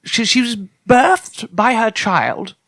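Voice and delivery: ordinary talk, nothing marked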